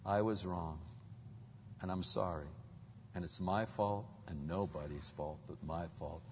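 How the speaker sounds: low, soft husband's confession; humble apology. Acted. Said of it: "that reluctance to say".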